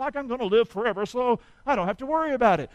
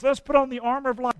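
normal talking voice

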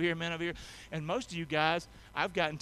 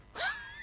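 1 sudden high laugh; 3 dB quieter than usual. Real, not acted. Laughs at own joke.

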